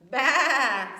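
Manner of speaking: loud mock bleat; hammed-up sheep noise. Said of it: "said Sheep".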